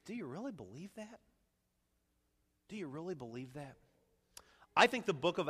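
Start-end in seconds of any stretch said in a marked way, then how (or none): 0.0-3.8 very soft, probing question; whispering heart. Acted. Preacher voices the heart questioning faith, hushed whispering. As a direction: ask it hushed, like an inner doubt. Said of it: "our heart's kinda whispering to us".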